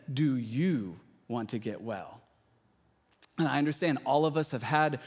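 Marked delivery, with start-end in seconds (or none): 0.1-2.2 slow, low and soft, searching; gentle question. Retold or imitated. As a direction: ask it gently, letting it land. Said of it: "He said".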